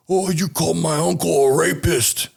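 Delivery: high-pitched imitation of an indignant little guy; put-on high voice, accusing; aggrieved kid confronting. Small attacker confronts him, acted out.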